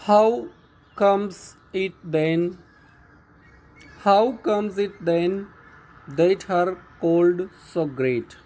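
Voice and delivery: drawn-out, lifted reading of "How comes it then"; slow, deliberate poem reading; slow poem recitation. Shown as a demonstration. Teacher recites poem line.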